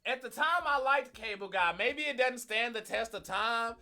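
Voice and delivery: loud, slow and emphatic. Real, not acted. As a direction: insist loudly, dragging it out. Heated argument over Cable Guy.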